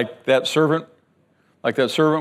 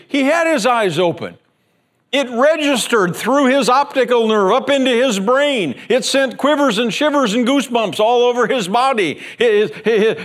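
higher, louder preaching at full force; fervent preaching; 7 semitones higher, 3 dB louder than usual. Real, not acted. Preacher to congregation, higher and fervent. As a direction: drive the point home with fire.

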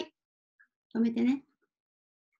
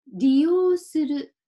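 利用する read slowly and louder, every mora clear; 5 dB louder, slower than usual. Shown as a demonstration. Teacher introducing a new word, slow and loud. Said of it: "これは、この用は".

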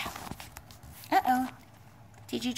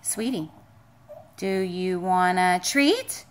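low drawn-out offer; 8 semitones lower, slower than usual. Real, not acted. Owner offering the dog a treat, low and slow. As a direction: lower and slow, stretching treat up at the end.

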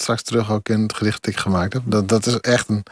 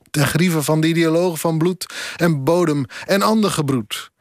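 higher-pitched, steady declaimed verse; poem recitation; 5 semitones higher than usual. Acted. Guest poet performs a late colleague's poem for the interviewer, declaimed recitation. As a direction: declaim it line by line, pitch held up.

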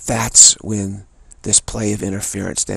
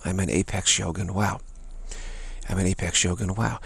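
quiet, steady chant of the affirmation; soft repeated mantra; 2 semitones lower, 7 dB quieter than usual. Shown as a demonstration. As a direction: repeat it softly to yourself, falling away. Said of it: "and then you go back".

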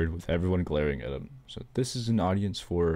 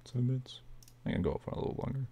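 fast whispered aside; quick aside; 6 semitones higher, 8 dB quieter, much faster than usual. Real, not acted. Reader muttering to herself about recording time, whispered. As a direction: rush a whisper off-mic.

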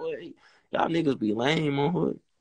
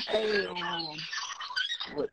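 normal talking voice

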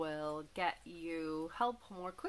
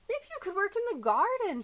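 put-on much higher, fast, louder child voice; eager little boy; 13 semitones higher, 7 dB louder, faster than usual. A narrator's reading. Jack pitches a garden job to the moose, high and excited. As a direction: squeak it out like an excited kid. Jack's hopeful suggestion.

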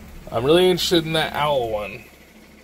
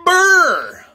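loud shivering brr; 11 dB louder than usual. Real, not acted. Coming in from cold.

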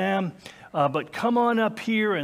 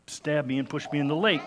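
ordinary delivery

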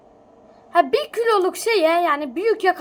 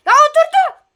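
loud, high startled cry; surprised shout; 13 semitones higher, 13 dB louder than usual. Real, not acted. Seeing the character sit on his boat, loud high startled cry. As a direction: blurt it out in shock.

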